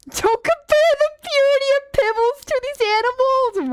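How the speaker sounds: very high, loud squealed protest; mock outrage. Streamer defending the purity of Pibbles, in squealing mock outrage. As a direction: shriek it in pretend indignation.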